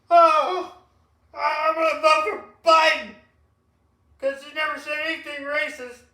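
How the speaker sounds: very high, very loud caricature; mock voter wail. Mimicking a Biden voter for the co-host, mock hollering. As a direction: holler it like a hammed-up zealot.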